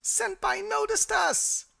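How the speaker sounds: high, slow squealing fangirl; excited anime fan. Pretend. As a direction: squeal it like a starstruck otaku. Fan squeal over Caesar mention.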